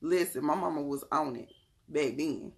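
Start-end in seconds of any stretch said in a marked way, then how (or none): none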